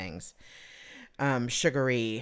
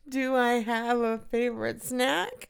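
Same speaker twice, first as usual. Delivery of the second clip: giggling; 10 semitones higher than usual. Real, not acted. Repeats question giggling.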